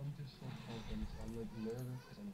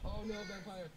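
sudden high alarmed cry; 11 semitones higher, 5 dB louder, slower than usual. Real, not acted. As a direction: blurt it out in alarm. Enemy appears, alarmed.

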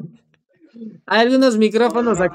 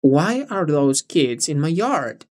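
slow model; 4 semitones lower, slower than usual. Shown as a demonstration. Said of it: "De nuevo".

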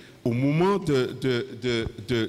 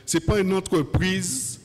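fiery preaching; 7 semitones higher than usual. Acted. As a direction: preach it out with full force. Preacher at full force.

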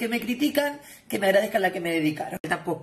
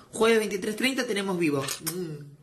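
ordinary talk

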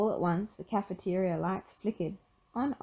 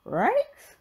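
high dog-voiced question; talking dog, pitched way up; Scooby; 5 dB louder than usual. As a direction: ask it as a startled cartoon dog. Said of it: "asked Scooby".

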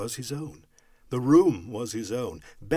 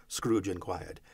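plain reading voice

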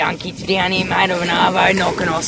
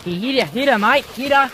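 urgent egging on; 5 semitones higher, 4 dB louder than usual. Real, not acted. Urging mate to spear fish.

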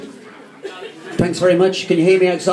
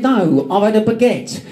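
higher voice in a badly faked accent; Dave Lee; 6 semitones higher than usual. Friend delivering the baguette punchline, bad impression. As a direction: imitate a mate's comic reply, pitched up. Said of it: "That impression was so bad".

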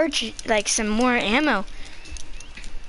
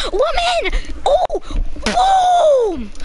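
high, loud, whooping shouts; excited yells; 4 dB louder, much slower than usual. Real, not acted. Gamer yelling at his screen, gleeful shouts. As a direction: holler it out with glee. Hitting a pedestrian in game.

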